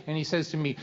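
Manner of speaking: ordinary delivery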